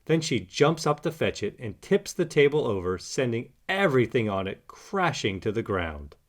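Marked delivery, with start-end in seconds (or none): none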